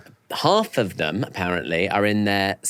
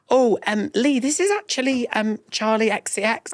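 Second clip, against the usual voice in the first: much higher, embarrassed prompt; awkward hint; 11 semitones higher than usual. Retold or imitated. The group correcting Lee, high and awkward. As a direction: nudge him awkwardly, high. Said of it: "everyone went".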